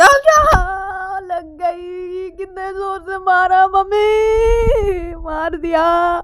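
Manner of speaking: loud, high, drawn-out mock wail; pained wailing. Ananya howls to mummy after the blow, pained wailing. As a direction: stretch every word into a long crying howl. Hit character wails for mother.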